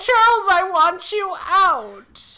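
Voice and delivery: high, loud enraged yell, last line slow; furious shout. Acted. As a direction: order him out at the top of your voice. Wife throws husband out.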